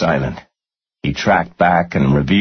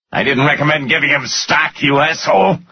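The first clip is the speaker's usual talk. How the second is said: high, indignant angry retort; yelled back; 9 semitones higher than usual. A narrator's reading. Finance guy denying blame to his boss, yelling back. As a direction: yell the denial back, pitched up. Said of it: "the guy yelled back".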